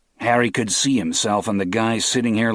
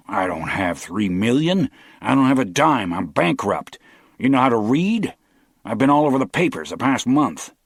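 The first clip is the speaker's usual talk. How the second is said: loud, raised indignant protest; exasperated outburst; 5 semitones higher, 4 dB louder than usual. A narrator's reading. Bankrupt captive loudly protesting the ransom demand. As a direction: push back, rising in anger.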